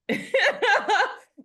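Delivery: loud, high burst of laughter; real amused laugh. Real, not acted. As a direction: laugh out loud at your own story. Laughs at her own story.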